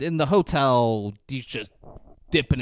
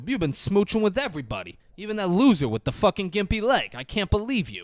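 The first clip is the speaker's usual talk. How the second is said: higher-pitched, acted-out scolding; mock accusation; 7 semitones higher than usual. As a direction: play at accusing a cheating lover. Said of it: "He was like".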